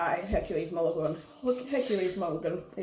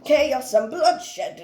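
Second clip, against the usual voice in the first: very slow, higher and louder English declaiming; put-on English accent; 5 semitones higher, 6 dB louder, much slower than usual. Englishman warning of bloodshed, in a drawn-out English accent. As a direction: stretch it out grandly in an English accent. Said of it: "this Englishman comes in".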